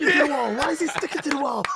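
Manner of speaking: mock whiny quote. Mock quoting game complaint.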